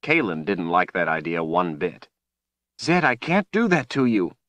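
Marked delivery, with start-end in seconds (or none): 2.8-4.4 high, pained protest; distressed refusal. A narrator's reading. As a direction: protest in anguish, voice pitched high. Horrified woman refusing the plea.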